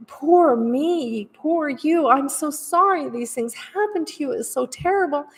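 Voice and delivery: high, syrupy sympathy with a mocking edge; mock pity. Mimicking an enabler who keeps pitying a struggling friend, mock pity. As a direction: coo over them like a pitying friend.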